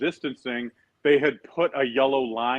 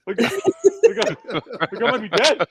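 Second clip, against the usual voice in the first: laughing mock alarm; 9 semitones higher, 7 dB louder, slower than usual. Player protesting, laughing retold.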